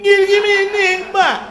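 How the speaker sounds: high, very loud mock order; hammed-up shouted food order; mocking loud customer. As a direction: imitate someone yelling an order. Mocks loud audience member again.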